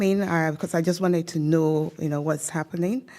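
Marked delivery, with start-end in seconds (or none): none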